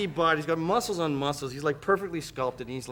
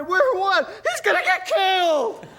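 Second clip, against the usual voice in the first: high, loud, frantic mock panic; wife panicking that her husband is going to die; panicked wife; 13 semitones higher, 4 dB louder, slower than usual. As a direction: cry out in alarm for her husband. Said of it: "started to cry".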